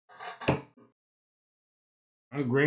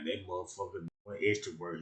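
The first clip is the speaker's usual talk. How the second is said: ordinary talk, nothing marked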